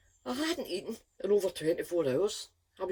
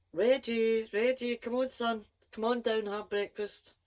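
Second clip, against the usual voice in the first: calling upstairs; 7 semitones higher than usual. Retold or imitated. Mother calling her son down to breakfast, raised calling voice. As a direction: call him down like a mother.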